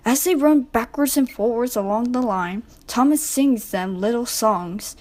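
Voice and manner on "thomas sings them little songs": ordinary delivery, nothing marked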